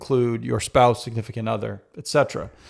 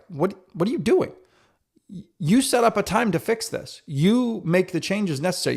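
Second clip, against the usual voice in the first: self-scolding; 6 semitones higher than usual. Retold or imitated. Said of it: "in my mind was like".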